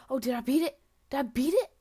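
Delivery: loud hopeful question, rising at the end; excited. Real, not acted. Player thinks a record fell, excited asking. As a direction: blurt it out with rising hope. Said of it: "I got so excited".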